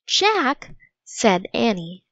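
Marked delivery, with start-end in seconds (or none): 0.1-0.7 worried. Acted. Annie fearing for the boy.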